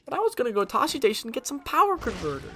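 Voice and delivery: high-pitched whiny teenager impression; mock whining. Streamer quoting a farm boy's complaint, high mock whine.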